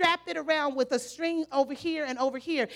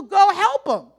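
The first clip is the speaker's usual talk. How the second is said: a hint of a commanding voice, louder; lightly voiced impatient God; 2 semitones higher, 10 dB louder, slower than usual. God answering the excuse, mild loud command. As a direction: toss it back like an impatient commander. Voicing God's impatient reply.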